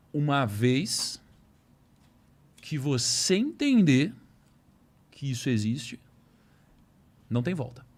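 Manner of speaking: slow, deliberate quoted warning; measured, weighty retelling of a warning; solemn warning. Retold or imitated. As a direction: spell out the warning phrase by phrase with long pauses.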